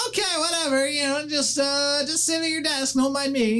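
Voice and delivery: very high, hurried panicky voice; young gnome caught without pants, flustered. Acted. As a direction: scramble to stall the visitor.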